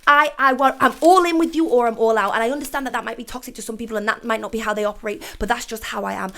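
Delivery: ordinary delivery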